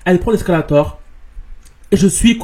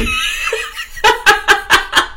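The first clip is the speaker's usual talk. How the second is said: shrieking laugh. Real, not acted. Rire après menace.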